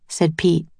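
ordinary delivery, nothing marked

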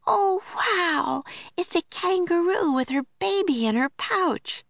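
put-on high, bright little-girl delight; a young girl thrilled to spot a kangaroo; excited Annie. A narrator's reading. Annie to Jack, spotting the kangaroo, high and delighted.

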